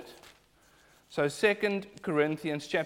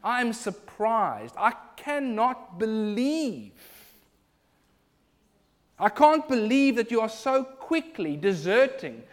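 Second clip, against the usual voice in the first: slow, building to a louder I can't believe; the apostle rebuking deserters, slow then louder; building indignation; 5 semitones higher, 5 dB louder, slower than usual. Retold or imitated. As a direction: start measured, rise to a forceful outcry. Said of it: "He's saying".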